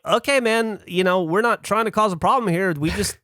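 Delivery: mildly pleading, imitated backpedalling; placating. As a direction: act out talking down an angry guard. Placating an angry guard in retelling.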